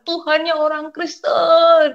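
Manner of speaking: high, drawn-out wail to God; retold tearful cry. Struggling mother calls out to God, retold tearful cry. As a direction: cry out slowly, imitating weeping. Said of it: "Sampai nangis".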